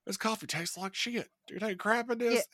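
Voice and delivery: high-pitched angry stepmom imitation; mock angry. Co-host acts out the stepmom over her coffee, mock angry. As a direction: mimic an angry woman's high Southern complaint. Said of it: "She was angry".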